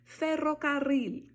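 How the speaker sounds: FERROCARRIL high, loud, slow, two trills; double rolled RR. Shown as a demonstration. Teacher testing learners, trilled RRs in ferrocarril. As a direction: roll ferrocarril twice, end on tongue-tip L. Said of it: "duas vezes o R R".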